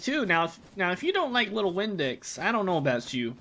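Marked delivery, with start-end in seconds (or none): none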